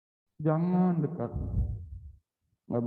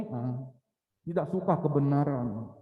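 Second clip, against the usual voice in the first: ordinary delivery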